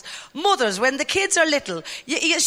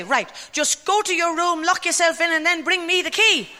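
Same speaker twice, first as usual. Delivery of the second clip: higher, brisk mock order; bossy mother; 5 semitones higher than usual. Mother sending a child to his room, mock stern. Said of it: "she'd say".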